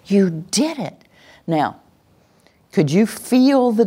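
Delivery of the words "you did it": drawn-out delighted praise; celebrating. Shown as a demonstration. Teacher celebrating a listener who followed the command, delighted praise. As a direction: celebrate their success. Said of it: "we want to celebrate them".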